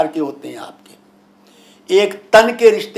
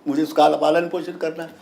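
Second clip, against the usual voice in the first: ordinary talk, nothing marked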